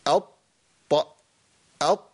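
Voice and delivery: أط with a short bouncing release on the ط; qalqala bounce. Shown as a demonstration. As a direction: clip أط and let the ط rebound. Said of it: "demo of qalqala on taa". (قلقلة خفيفة على الطاء في أط)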